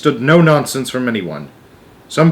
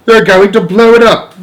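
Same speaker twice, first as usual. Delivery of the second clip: high, loud panicked military man; alarmed cry; 10 semitones higher, 10 dB louder than usual. Acted. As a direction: yell the alarm at full force. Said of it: "cried the chief of the army".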